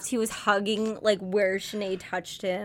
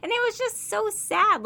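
overwhelmed fan wail; high lament; 13 semitones higher, 4 dB louder than usual. Real, not acted. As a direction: pitch jumps way up on sad. Lamenting the scene.

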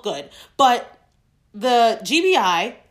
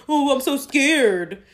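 mock scared; 3 semitones higher, 3 dB louder, slower than usual.

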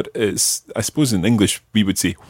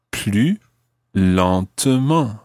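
each syllable of plus lentement drawn out slowly; much slower than usual. Shown as a demonstration. Teacher models the phrase slowly for a learner. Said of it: "it would be quite useful to know the phrase for "more slowly".

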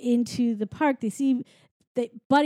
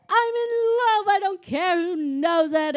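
giddy, crazy outburst; 8 semitones higher than usual. Retold or imitated. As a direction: imitate someone wildly, giddily in love. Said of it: "being crazy".

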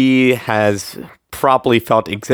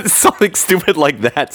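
higher-pitched teasing echo through a laugh; amused mocking echo; 6 semitones higher than usual. Co-host teasing a writer about his plot, amused echo. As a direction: parrot it back, amused.

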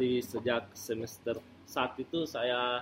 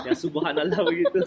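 laughing; 5 semitones higher, 5 dB louder than usual. Real, not acted. Laughs describing the lecturer.

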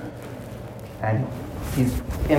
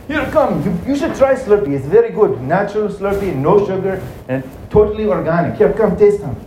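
higher-pitched, pushy shopkeeper pitch; eager Slurpee seller; 7 semitones higher than usual. Acted. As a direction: talk up your product in a high, lilting voice.

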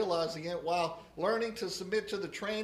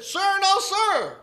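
very loud, high recruit shout; 12 semitones higher, 13 dB louder, slower than usual. Retold or imitated. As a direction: scream it at attention like a recruit. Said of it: "the recruit shouted".

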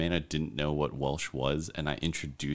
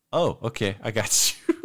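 mock confident stranger; 4 semitones higher, 6 dB louder than usual. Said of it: "They're like".

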